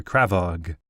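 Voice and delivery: ordinary delivery, nothing marked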